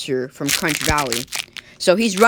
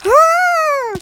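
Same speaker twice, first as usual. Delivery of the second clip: villagers' scream; 8 dB louder than usual. Acted.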